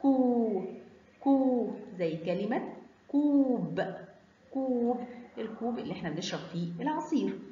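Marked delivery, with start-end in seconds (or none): 0.0-1.7 كو held as a long "kuu"; separate, drawn-out كو; long waw sound. Shown as a demonstration. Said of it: "كاف ضمة مد بالواو". 3.1-5.0 كوب with a long "uu"; drawn-out "kuub" for كوب; long waw sound. Shown as a demonstration. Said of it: "مد بالواو".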